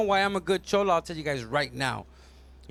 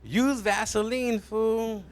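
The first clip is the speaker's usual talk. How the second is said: ordinary delivery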